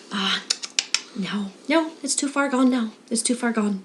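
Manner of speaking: soft, resigned vet; retold vet quietly giving up; quiet verdict. Vet gives up on hamster.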